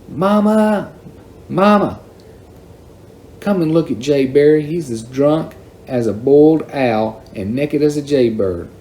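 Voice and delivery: high-pitched call, easing into tattling; yelling for Mama. A narrator's reading. Daisy yelling toward the house for Mama, high call. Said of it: "Daisy yelled in a voice louder than I had ever heard".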